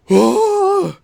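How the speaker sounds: gasp, then a loud high "oh"; mock audience shock, high and loud. Viewer as he wakes her up, shocked gasp. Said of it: "And you're just like".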